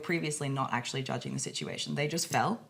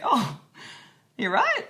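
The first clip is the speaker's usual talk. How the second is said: upbeat parent; 9 semitones higher, 7 dB louder, slower than usual. Retold or imitated. Parent casually checking fallen child.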